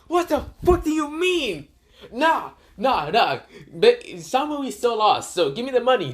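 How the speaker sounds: high-pitched arguing yell; indignant protest. Retold or imitated. Naruto disputing the tie with Dragon, indignant protest. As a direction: argue back high and indignant.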